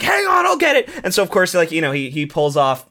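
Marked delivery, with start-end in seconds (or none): 0.0-0.9 high, urgent mock shout; hammed-up heroic holler; urgent calling out. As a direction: call out as if rushing to the rescue. Acting the would-be rescuer shouting.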